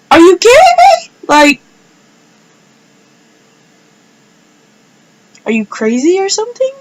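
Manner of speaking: real outrage over the killed puppy; outraged cry. Real, not acted. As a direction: voice breaking in fury.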